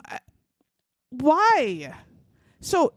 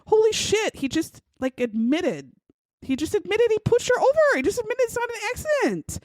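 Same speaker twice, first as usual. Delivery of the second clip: excited detective; 9 semitones higher than usual. Retold or imitated. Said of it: "and he says".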